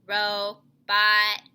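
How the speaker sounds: low, monotone robot voice; stiff, separated robot words; robot reading. Shown as a demonstration. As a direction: read it word by word like a robot. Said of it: "reading word by word like a robot".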